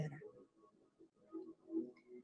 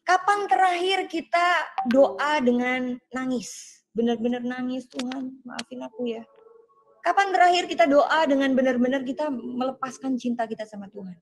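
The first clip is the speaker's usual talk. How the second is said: rising, louder preacher's appeal, higher each question; preaching; 3 semitones higher, 3 dB louder than usual. Acted. Pastor challenges online church about cold prayer, preaching. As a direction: press each "sudah berapa lama" harder.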